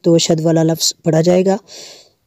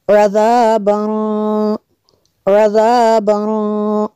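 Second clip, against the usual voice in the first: slow recitation; 3 semitones higher, much slower than usual. Shown as a demonstration. Echo recitation drill.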